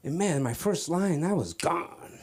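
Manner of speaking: normal talking voice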